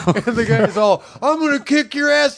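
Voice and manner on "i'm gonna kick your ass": high, strained threat; mock angry threat. Storyteller as the pissed man threatening a teen, high mock anger. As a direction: threaten in a pitched-up comic rage. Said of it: "he was pissed".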